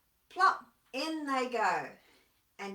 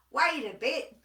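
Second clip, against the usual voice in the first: louder indignant echidna; bristling; 3 semitones higher, 8 dB louder than usual. Acted. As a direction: cut them off in a huff. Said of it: "he bristled".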